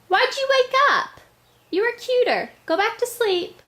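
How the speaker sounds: pet talk. Real, not acted. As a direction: coo at a sleepy puppy. Talking to her sleeping dog.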